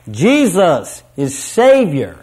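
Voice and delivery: voices joined in a ringing, drawn-out proclamation; triumphant declaration. Acted. Preacher acting inmates joining their voices, triumphantly. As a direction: declare it triumphantly, slow and punched. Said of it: "join their voices triumphantly as they declare".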